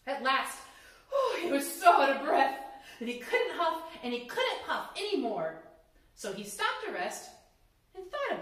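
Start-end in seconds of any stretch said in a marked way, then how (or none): none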